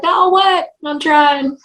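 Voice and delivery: high-pitched defensive whine in retelling; mock protest. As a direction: protest as if unfairly scolded. Retelling her protest to Jimmy.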